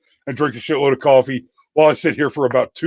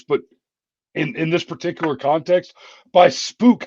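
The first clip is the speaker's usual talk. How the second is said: ordinary talk, nothing marked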